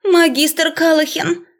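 высокий, растянутый жалкий голос; пролепечи медленно и высоко, заискивая; жалобно. A narrator's reading. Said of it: "Голос Розали Грэдис звучал жалко". (жалобный голос преподавательницы)

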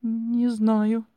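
high, loud, tearful stammer; distressed, halting whine; stammering wail. A narrator's reading. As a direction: wail it shakily, tripping on the first sound. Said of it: "заикаясь, ответила я".

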